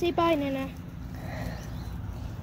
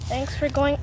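ordinary talk

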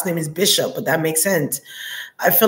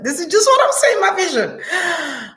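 laughing insistence; 10 semitones higher than usual. Real, not acted. Laughing while insisting on vision.

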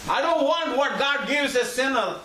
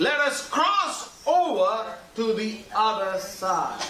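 slow emphatic repeat; slower than usual. Shown as a demonstration. Repeats verse slowly for emphasis.

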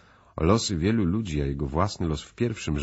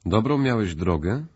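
lilting, courteous host's question, pitched higher; polite inquiry; 5 semitones higher, 4 dB louder than usual. A narrator's reading. Host greeting his cousin after the journey, politely. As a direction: ask politely, with a rising lift. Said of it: "spytał uprzejmie gospodarz".

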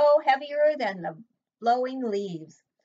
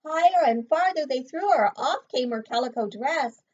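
lively, higher read-aloud voice; story narration; 4 semitones higher, 6 dB louder than usual. A narrator's reading. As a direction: build the drama for the child. Dramatic story reading.

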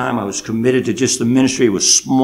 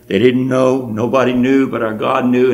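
ordinary delivery

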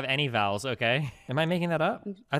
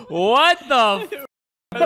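much higher, louder laughing disbelief; shrieking laugh; 10 semitones higher, 6 dB louder, much slower than usual. Real, not acted. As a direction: laugh out a shocked what the. Reacting to Dan's accent.

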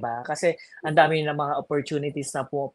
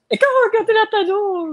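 teasing retort; 5 dB louder than usual. Real, not acted.

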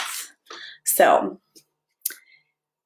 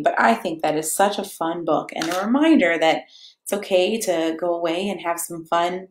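plain reading voice

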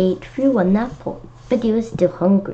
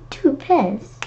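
surprised; 6 semitones higher than usual. Real, not acted. Reacting to the caterpillar's meal.

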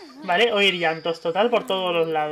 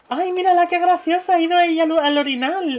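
high, loud and delighted; bright gushing delight, fading at the end; 8 semitones higher, 5 dB louder than usual. Real, not acted. Baby used the potty.

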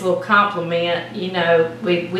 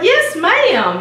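excited; 10 semitones higher than usual. Retold or imitated. Insisting to humble Miss Joyce.

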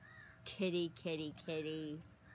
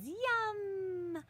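big excited yum; 12 semitones higher than usual. Acted. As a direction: squeal it with delight. Bad Kitty says, excited cat.

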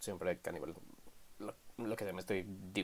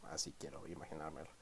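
usual speaking voice

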